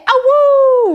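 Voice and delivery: high, loud wolf howl. Pretend. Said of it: "John Burkett".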